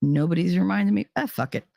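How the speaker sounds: a breezy shrug of a thought; daughter's carefree brain. Retold or imitated. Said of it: "her brain's saying".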